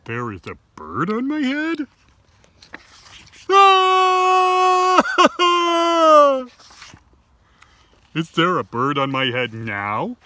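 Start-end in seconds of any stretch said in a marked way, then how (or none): 3.5-6.5 loud high scream then laugh; high shriek. Acted. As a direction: shriek in panic. Pig shrieks at bird.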